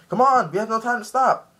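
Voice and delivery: high, loud imitation of urging on; urgent shout. Ivankov urging them on.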